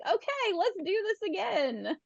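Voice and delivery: high, drawn-out mock-eager quote; renewed eagerness. Racer talking themselves into another go, playfully eager. Acted change of heart, eager again.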